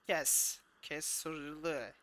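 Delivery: usual speaking voice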